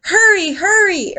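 high, loud urging; eager storybook urging; urged. Acted. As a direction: push a friend to run. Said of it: "urged Bear".

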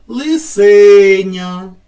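"лисеня" with се punched loud and long; wrong middle-syllable stress. Shown as a demonstration. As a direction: call out "лисеня", leaning hard on the middle syllable. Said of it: "на який склад падає наголос".

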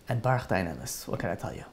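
low, soft, resigned; breaking bad news. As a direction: drop to a hushed, helpless murmur. Doctor breaks news of death.

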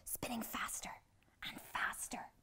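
hushed whisper; soft whispered build. A narrator's reading. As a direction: breathe it out as it speeds. Spinning out of control.

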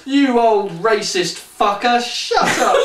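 hammed-up furious woman; mock scream. A narrator's reading. The woman screams at the old man, mock-furious, high, with a laugh. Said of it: "the woman screamed".

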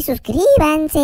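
ordinary talk, nothing marked

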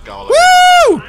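high loud cheer; excited woo. Real, not acted. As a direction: let out a big whoop.

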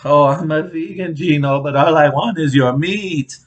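high, loud mock female voice; loud, high-pitched caricature of a girlfriend; imitated woman. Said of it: "when you hear her say".

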